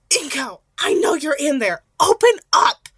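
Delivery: loud pounding-door yell; shouting. A narrator's reading. Mitsuki shouting at the door to be let in, loud yell. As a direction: bellow through the door to get let in. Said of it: "the woman shouted".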